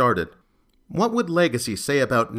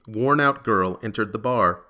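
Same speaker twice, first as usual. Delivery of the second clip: ordinary delivery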